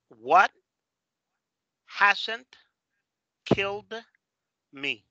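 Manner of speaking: WHAT, HASN'T, KILLED, ME with long pauses between; word-by-word demo. Shown as a demonstration. Teacher reads words written in colours, one isolated word at a time. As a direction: say each word alone, pausing after it. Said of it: "En colores puedes escribir".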